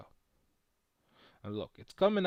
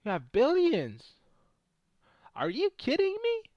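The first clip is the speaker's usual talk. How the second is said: incredulous outburst; 13 semitones higher, 4 dB louder than usual. Retold or imitated. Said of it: "I was like".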